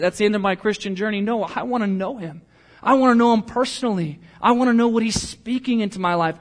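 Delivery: ordinary delivery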